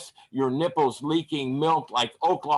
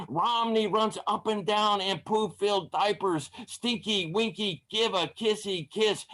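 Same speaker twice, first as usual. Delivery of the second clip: put-on high, sing-song baby voice; teasing baby talk; mock baby talk; 8 semitones higher than usual. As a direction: coo it like mocking a toddler. Mocking baby talk in poem.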